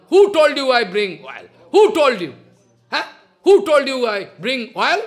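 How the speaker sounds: loud, high indignant outburst; angry shout. Retold or imitated. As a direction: bark it out like an offended devotee. Jagadananda angrily refusing, retold.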